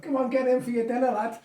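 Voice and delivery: imitating mother calling kids home; mum calling out. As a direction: call the lad in for dinner across the field. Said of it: "your mother would shout".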